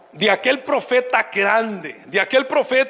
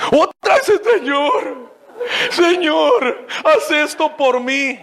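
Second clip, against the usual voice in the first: mock whiny prayer; 3 semitones higher, slower than usual. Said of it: "otra vez pidiéndome".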